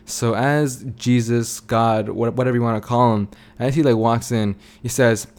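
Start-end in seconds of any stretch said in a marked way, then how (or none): none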